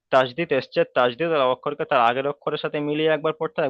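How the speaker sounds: ordinary delivery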